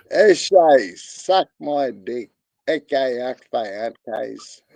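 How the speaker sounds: loud eshay lad voice; mock Aussie eshay talk; aussie eshay slang.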